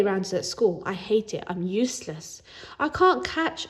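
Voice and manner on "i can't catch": ordinary delivery, nothing marked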